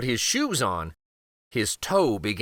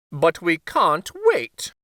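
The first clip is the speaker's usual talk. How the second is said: slow, higher, louder objection; firm queen; 5 semitones higher, 4 dB louder, slower than usual. Acted. Queen to the king, slow firm objection. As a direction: lay it down firmly as the queen. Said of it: "said the queen".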